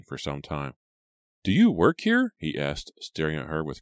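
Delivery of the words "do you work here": high, louder, irritated question; impatient. Acted. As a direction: demand an answer from the clerk. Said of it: "the impatient frown on his face".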